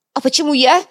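high, loud indignant squeal. A narrator's reading. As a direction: squeal in protest. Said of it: "взвизгнул Шкварка".

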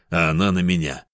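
usual speaking voice

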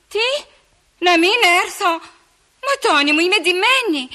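high-pitched, disbelieving protest; plead, taken aback, voice climbing; dismayed surprise. Acted. Έκπληκτη διαμαρτυρία στον εραστή. (έκπληκτη, απογοητευμένη διαμαρτυρία)

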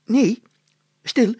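high loud cry for silence; calling out. A narrator's reading. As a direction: shout them down sharply. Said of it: "riep de burgemeester". (hoog en luid roepen)

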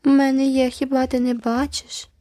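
high mocking parrot of her words; mocking mimicry. A narrator's reading. Girl parroting a rival to tease her, high. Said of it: "знову передражнила Джеральдіна".

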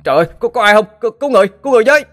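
very high, loud desperate screams; terrified shouting for help at the riverbank; panicked cry for help. A narrator's reading. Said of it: "kêu lên thất thanh".

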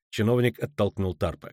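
plain reading voice

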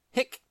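put-on a high, squeaky drunk hiccup; drunken hiccup. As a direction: hiccup like a sozzled squirrel.

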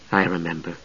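very soft, low reply; barely said. A narrator's reading. Palin answering the knight's reminder, barely audible. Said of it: "though just barely".

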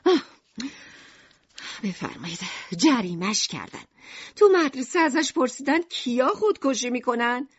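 a weary mother explaining her son's punishment; voiced mother Lucrèce. A narrator's reading. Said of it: "لوکریس ... گفت".

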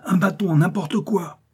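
high, urgent call for help; desperate cry. A narrator's reading. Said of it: "cria Korzoff". (appel pressant, voix haut perchée)

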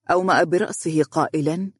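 plain reading voice

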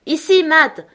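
loud, high call across the yard; shouting. Acted. Scott shouts to Matt to stop him, loud and high. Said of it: "cria Scott".

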